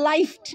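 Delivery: লাইফট with an f before the t, for "light"; wrong, f sounded. Shown as a demonstration. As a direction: misread "light" as লাইফট, gh turned into f. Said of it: "এরকমও বলি না". ("light"-কে ভুল করে ফ দিয়ে লাইফট বলা)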